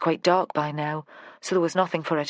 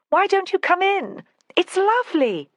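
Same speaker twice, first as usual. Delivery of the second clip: high, loud, gleeful call; a carefree, delighted shout from the water; playful invitation; 14 semitones higher, 5 dB louder than usual. Retold or imitated. Joanna calls Vera into the tarn, high and gleeful. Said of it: "She'd laughed out loud".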